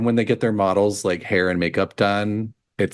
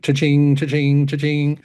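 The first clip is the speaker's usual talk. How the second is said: higher, even, repeated cha-ching; cash-register jingle; 6 semitones higher than usual. Retold or imitated. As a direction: imitate a till ringing up money. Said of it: "it's like".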